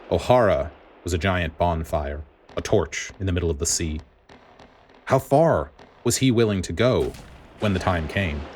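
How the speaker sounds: plain reading voice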